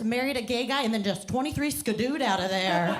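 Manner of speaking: ordinary talk, nothing marked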